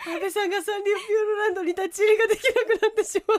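high-pitched words squeezed out through laughter; cracking up while speaking; helpless laughter. Real, not acted. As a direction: try to finish the sentence while laughing hard. Cracking up at risky dare.

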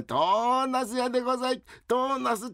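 vendor cry. Acted. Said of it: "vendor cry in alley".

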